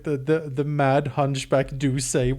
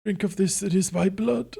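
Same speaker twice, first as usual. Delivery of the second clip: raised, solemn priestly offering; vampire priest; 4 semitones higher than usual. Acted. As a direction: intone it like a dark priest.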